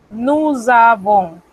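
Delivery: slow sing-song Nous avons with a buzzing Z; rhythmic liaison. Shown as a demonstration. Teacher chanting nous avons slowly, rhythmic liaison. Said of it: "con este pequeño ritmo".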